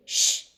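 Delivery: soft "shh" hush; shushing. Acted. Devan cuts off her excuse in the plane, shushing.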